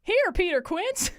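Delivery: high, loud, eager reply; young Flute calling out to Quince; eager high answer. Acted. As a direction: pipe up to answer the roll call. Flute answers the roll call.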